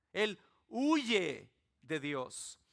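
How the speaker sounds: usual speaking voice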